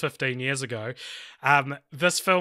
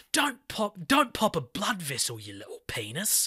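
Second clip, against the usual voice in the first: Jason Statham taunting; 4 semitones higher, 4 dB quieter than usual. Retold or imitated. Hero antagonizing an angry villain on the phone, imitated taunt. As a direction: goad him in a put-on voice, falling away softer. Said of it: "he's antagonizing the villain".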